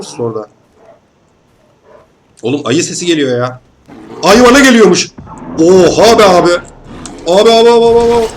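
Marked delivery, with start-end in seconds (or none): 4.2-5.1 much higher and louder, real alarm; real panic at the charging bear; panicked shout. Real, not acted. Bear charging at him in game. 5.6-6.5 loud high yelp, be stressed; real shock and fear; panicked cry. Real, not acted. Bear charging at him. 7.3-8.3 high, loud repeated abi in panic; real panic; frantic shouting. Real, not acted. Bear attacking him.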